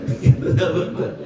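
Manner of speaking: laughing the question out; teasing laugh at the congregation. Real, not acted. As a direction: ask it through a breathy laugh. Repeats question, audience laughs.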